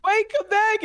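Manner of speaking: put-on high, pleading girl voice; imitating a girl. Retelling a video girl calling her guinea pig, high plea. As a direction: plead after the lost pet. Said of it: "and then she's like".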